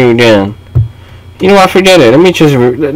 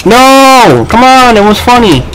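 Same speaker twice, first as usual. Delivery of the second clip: high, stretched-out pleading; whining protest; 8 semitones higher than usual. Real, not acted. Player protesting to someone in the match, whiny. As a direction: plead it high, dragging the words.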